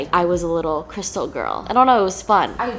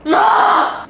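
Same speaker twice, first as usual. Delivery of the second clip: high, loud "ah" of delight; ecstatic scream; 11 dB louder than usual. Pretend. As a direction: scream with over-the-top delight. Overcome by how good cookie is.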